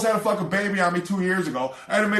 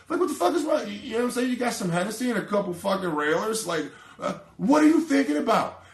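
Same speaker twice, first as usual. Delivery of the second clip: exasperated rant; 6 semitones higher than usual. Real, not acted. Ranting at a player's blunder.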